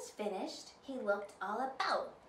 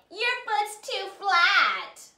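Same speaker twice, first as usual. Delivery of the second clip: loud, higher, slow taunt; mocking stepsister; 10 semitones higher, 13 dB louder, slower than usual. A narrator's reading. Nell taunting Sue after she falls, slow loud jeer. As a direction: jeer at her sister's flat foot. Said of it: "laughed Nell".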